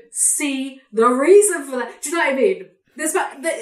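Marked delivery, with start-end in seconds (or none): 0.1-2.0 slow, louder put-on narrator drawl; fake narrator voice. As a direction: stretch it out like a phony American narrator. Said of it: "they'll go".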